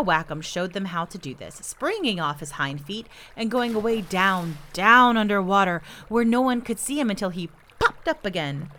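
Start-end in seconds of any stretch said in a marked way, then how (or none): none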